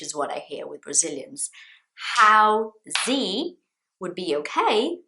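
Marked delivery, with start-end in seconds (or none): 2.2-3.6 "Housey" slow and loud, HOW then a rising ZEE; two-syllable housey. Shown as a demonstration. Teacher shows what is not the word, "Housey" as how-zee. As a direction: stretch "Housey" into two beats with a Z. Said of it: "that's not the word".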